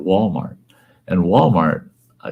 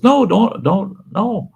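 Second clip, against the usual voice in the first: much higher, quick denial; brother protesting; 4 dB louder, slower than usual. Retold or imitated. Brother brushing off pity, high protest. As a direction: wave off the sympathy.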